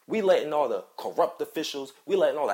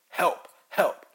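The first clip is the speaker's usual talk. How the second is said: mild mock scream, higher and louder; retold cry for help; 5 semitones higher, 5 dB louder than usual. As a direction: imitate a kid's scream for help, held back. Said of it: "a kid screaming, crying out like that".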